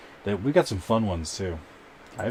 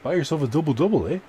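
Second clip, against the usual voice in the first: mock Canadian accent, pitched higher, landing on "eh?"; John Barnett Canadian accent; 8 semitones higher than usual. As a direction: ham up a Canadian "double double, eh?" a little. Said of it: "I just imitate John Barnett".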